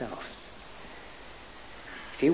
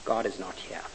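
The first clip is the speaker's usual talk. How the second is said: hushed echo; 7 semitones lower, 7 dB quieter than usual. A narrator's reading. A hideous echo answers the lost man softly. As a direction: lower and much softer, a hollow echoing hush. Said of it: "hideous echo whispering".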